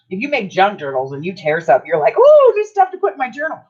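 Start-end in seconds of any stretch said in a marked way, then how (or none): 2.2-3.6 high, loud squeal of mock delight. Junk journal maker spotting scraps to save, hammed-up delight. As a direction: pipe up thrilled, as if spotting treasure.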